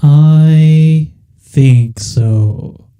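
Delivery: very slow, loud whale voice; giant whale voice. Acted. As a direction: drag each word out like a giant beast. Whale answers the party.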